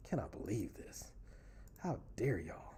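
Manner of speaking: murmured theatrical disappointment; mock disappointment. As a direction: sigh it out like a let-down parent.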